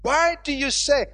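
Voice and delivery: emphatic, stretched pulpit delivery; forceful, swelling sermon cadence; rebuking preaching. Real, not acted. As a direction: scold the doubters, then proclaim the promise.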